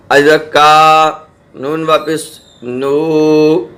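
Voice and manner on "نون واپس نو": نون واپس نو with the oo of نو held very slowly; long oo of waw madd; stretched waw madd. Shown as a demonstration. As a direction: draw out نو. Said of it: "واو مدہ کہتے ہیں".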